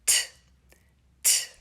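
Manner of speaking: name T then the crisp t sound; letter sound t. Shown as a demonstration. Teacher to preschoolers, modelling the t sound. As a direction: say T, then clip the bare t sound. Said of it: "what sound do we make for the letter T".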